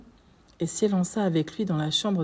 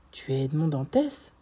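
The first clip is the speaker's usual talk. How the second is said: usual speaking voice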